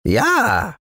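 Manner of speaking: very high, thin little dwarf; high-pitched tiny man's voice; fine dwarf voice. A narrator's reading. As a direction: pipe it up like a tiny woodland gnome. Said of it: "sagte der Zwerg mit feiner Stimme".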